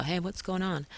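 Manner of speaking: a hint of a snooping old neighbor peering out; mild mock nosy old lady calling out. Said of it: "that nosy old lady looking out the window".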